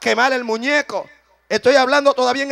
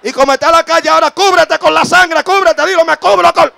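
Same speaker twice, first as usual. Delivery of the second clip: loud, high revival shouting, building; impassioned preacher's shouts rising in pitch; full-force preaching; 4 semitones higher, 10 dB louder than usual. Real, not acted. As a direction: drive the congregation to declare it, climbing to a scream. Preaches at full force, leading declaration.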